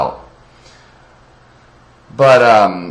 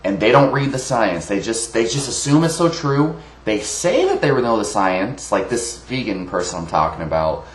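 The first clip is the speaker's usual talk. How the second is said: normal talking voice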